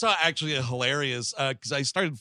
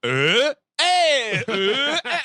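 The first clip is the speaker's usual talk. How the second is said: loud, high confused yelps; hammed-up groggy alarm noises; startled waking grunts; 10 semitones higher, 7 dB louder, much slower than usual. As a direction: jolt awake with mock bewildered grunts. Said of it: "he wakes up like".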